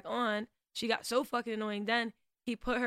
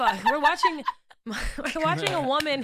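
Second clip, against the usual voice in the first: laughing, loud and high, correcting himself; 5 semitones higher, 9 dB louder than usual. Real, not acted. Fumbling his own words, laughing hard. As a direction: breaks into laughter mid-sentence.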